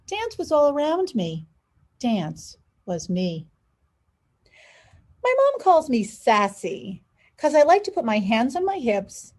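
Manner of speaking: lively storybook read, big pitch swings; animated picture book narration; reading to children. A narrator's reading. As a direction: read aloud to kids with expression. Reads picture book aloud.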